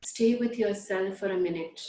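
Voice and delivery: usual speaking voice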